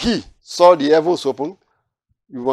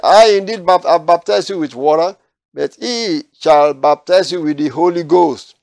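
raised, solemn reading of the verse; scripture reading; 3 semitones higher, 6 dB louder than usual. Acted. Preacher reading the verse to his study group, solemn and raised. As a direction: proclaim the prophecy from the Bible.

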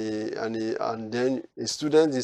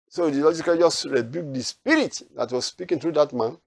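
ordinary talk

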